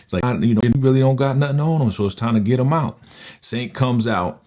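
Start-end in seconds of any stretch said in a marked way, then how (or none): 0.2-3.0 higher, quick country-accent imitation. Lawyer arguing to get Saint out, imitated country accent. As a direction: put on a light country twang, talking fast. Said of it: "talking a country accent".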